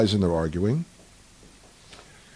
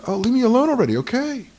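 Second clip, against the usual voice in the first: exasperated God; 14 semitones higher, 6 dB louder than usual. Retold or imitated. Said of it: "God says".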